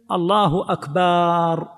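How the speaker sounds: "أكبر" drawn out as "akbaaar"; stretched baa in akbar. Shown as a demonstration. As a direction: slowly hold the vowel after the b in "أكبر". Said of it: "يمد الباء". (مدّ باء «أكبر»: أكباااار)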